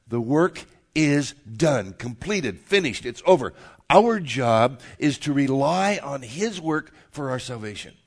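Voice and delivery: loud, deliberate declarations with weighty pauses; emphatic preaching. Real, not acted. Pastor declaring the gospel to the congregation, emphatic preaching. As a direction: preach it firmly, landing hard on key words.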